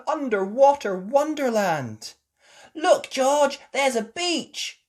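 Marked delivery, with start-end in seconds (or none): 2.8-4.8 put-on high, loud amazed child; amazed gasp. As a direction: gasp it out in wonder. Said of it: "gasped Peppa".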